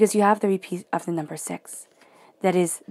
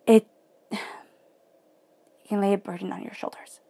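choked up. Real, not acted. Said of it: "it's making me choked up right here".

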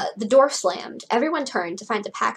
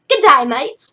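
loud, higher Australian greeting; terrible Australian accent; 5 semitones higher, 10 dB louder than usual. Acted. Blue greets her friends, in hammed-up Australian accent. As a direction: call out in a bad Australian accent. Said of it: "my terrible Australian accent".

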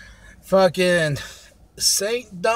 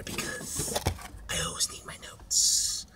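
whisper; 15 dB quieter than usual. Real, not acted. Aside about needing notes.

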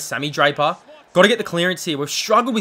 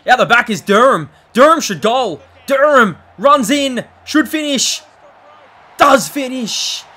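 building shout, much louder and higher; excited goal call; 6 semitones higher, 8 dB louder than usual. Real, not acted. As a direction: yell it out as the goal goes in. Live goal call, excited commentary.

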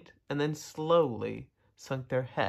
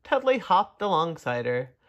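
usual speaking voice

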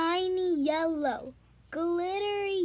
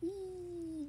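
ordinary delivery

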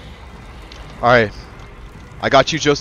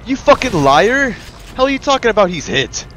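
angry shout; 9 semitones higher than usual. Real, not acted. Player yelling at teammate who claims he is hit, angry accusing shout. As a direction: call him a liar at full voice.

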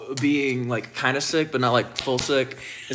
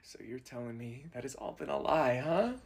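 hushed disbelief; 3 semitones lower, 12 dB quieter than usual. Acted. Mock betrayal.

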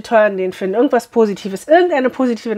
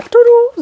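high, loud sung ta-da; fanfare tudu; 13 semitones higher, 6 dB louder than usual. Acted. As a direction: reveal it like a little fanfare. Unveiling the box contents. (hohes, lautes Tusch-Tudu)

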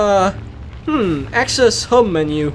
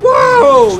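high, loud burst of amazement; amazed whoa; 14 semitones higher, 6 dB louder than usual. Real, not acted. Player sees a complete flip, amazed high shout. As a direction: yelp a big high whoa.

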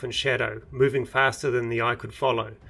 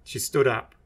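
usual speaking voice

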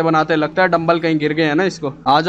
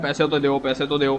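mock whiny nagging, a bit softer; mocking imitation; 4 semitones lower, 6 dB quieter than usual. Father mocks his daughter's nagging for money, mock whine. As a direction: parrot the daughter's demand mockingly. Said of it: "शोर पहले डाल रहा है".

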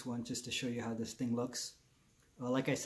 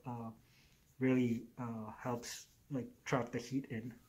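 muffled by fabric covering; speaking through balaclava; through a face mask; slower than usual. Real, not acted. Seen 4: Fleece balaclava covers mouth and nose throughout; references show bare face.

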